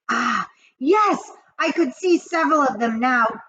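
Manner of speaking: ordinary delivery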